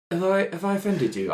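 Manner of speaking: ordinary talk, nothing marked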